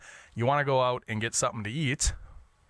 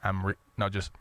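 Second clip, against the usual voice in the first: usual speaking voice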